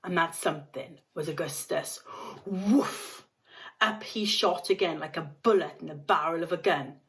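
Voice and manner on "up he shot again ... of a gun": ordinary delivery, nothing marked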